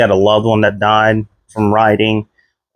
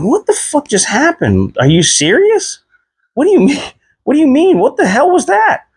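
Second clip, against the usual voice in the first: high, rising incredulous rant; retold outraged disbelief. Patient alone after the nurse leaves, high incredulous rant. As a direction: keep climbing in pitch as the disbelief grows.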